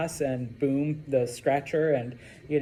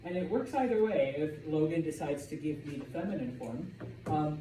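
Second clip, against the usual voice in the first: usual speaking voice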